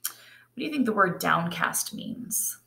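ordinary delivery

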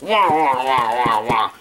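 wah wah puppet babble, some shouted; muffled wah wah talk. Pretend. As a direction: flap the puppet mouth with wah sounds. Puppet mouth flapping demo.

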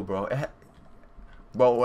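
ordinary talk, nothing marked

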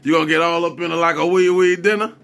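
ordinary talk

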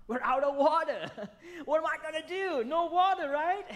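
high, hammed-up alarm with a laugh; mock panic. Preacher acting Elijah out of water, mock panic.

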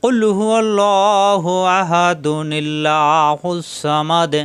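slow chanted tilawat with long drawn vowels; Quran recitation. Shown as a demonstration. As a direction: recite slowly, stretching each vowel. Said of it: "সবাই খেয়াল করুন কীভাবে পড়তে হবে।".

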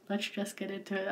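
ordinary talk, nothing marked

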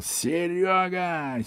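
drawn-out high call to a neighbour downstairs; slow high call. Acted. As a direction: stretch the name out high and slow, calling down. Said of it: "Джонни завопил".

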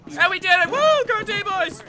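high, loud victory whoop; cheering. Real, not acted. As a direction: celebrate like you won. Celebrating opening door.

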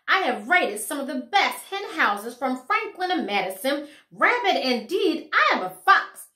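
proud, puffed-up fox voice, loud and high; pompous fox. A narrator's reading. Fox lecturing Flossie on his pedigree, proud and loud. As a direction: boast like an insulted gentleman fox.

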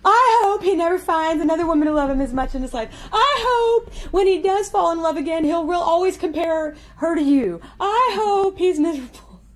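high, loud, half-laughing rant through mock tears; mom's angry wishes. Daughter voicing her mom cursing the ex-boyfriend, high laughing-crying rant. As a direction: rail at him, laughing and crying at once. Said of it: "And she'd go".

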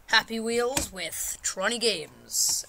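loud, drawn-out showman greeting; showy welcome. Pretend. Gamer greets viewers of a new solo channel, showy. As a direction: hail the crowd grandly, stretching each word. Hyped show intro opening.

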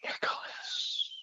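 slow, airy ghostly whisper. Retold or imitated. Ghost whispering the doubting kid's name, slow. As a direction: breathe the name slowly as a spirit would. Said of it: "I'll whisper it".

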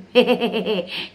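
rippling run of giggles; gloating laugh. Real, not acted. Streamer saying it serves them right, gloating laugh. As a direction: chuckle at a rival's trouble.

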